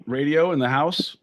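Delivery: ordinary talk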